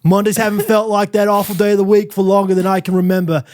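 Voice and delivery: hammed-up Australian accent, stretching key words; bad Australian accent. Podcaster reading a fan's review in a bad Australian accent. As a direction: overdo a wobbly Australian-London accent. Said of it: "it's a really good Australian accent".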